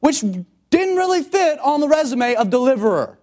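high, loud preaching at full force; fiery preaching. Acted. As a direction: preach it out to the whole room. Preacher building to a climax.

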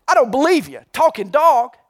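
disbelief. Acted. Joke: maitre d' doubts the owner.

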